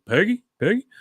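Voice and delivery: lightly voiced searching call. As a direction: call around the room. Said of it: "looks around and goes".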